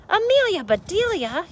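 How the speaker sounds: high, sharp call of her name; yelled. A narrator's reading. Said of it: "yelled Mister Rogers".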